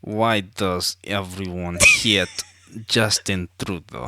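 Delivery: slow, low Russian accent. Shown as a demonstration. Co-host demonstrating the friend's question, Russian accent. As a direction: say it word by word in a put-on Russian accent. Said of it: "I'll try to do it".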